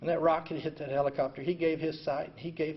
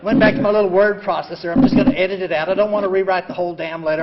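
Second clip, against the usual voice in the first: ordinary talk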